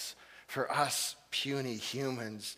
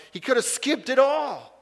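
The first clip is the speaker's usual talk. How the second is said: high, loud cry to the congregation; preacher at full force; preaching shout; 11 semitones higher, 9 dB louder than usual. Acted. Preacher builds to a climax.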